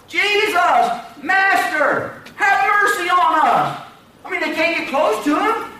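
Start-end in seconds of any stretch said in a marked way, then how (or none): 0.1-3.8 high, pleading shout; crying out. Acted. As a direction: shout a desperate plea across a distance. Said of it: "Crying out".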